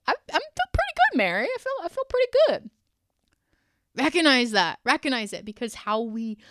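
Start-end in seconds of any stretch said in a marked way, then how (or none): 0.1-2.7 high, quick imitation of a cheerful listener; chirpy imitation. Imitating a listener who feels good, high and quick. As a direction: pop the voice up high and perky.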